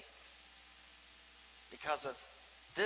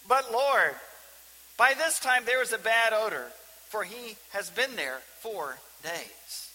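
protesting Martha; 5 semitones higher, 7 dB louder than usual. Acted. Martha objecting, preacher laughs first.

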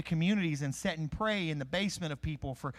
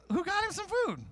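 much higher, bewildered disciples asking around; puzzled disciples; 13 semitones higher than usual. Retold or imitated. Disciples puzzling over who fed Jesus, much higher and bewildered. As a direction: squeak it out like baffled friends. Disciples voiced in retold story.

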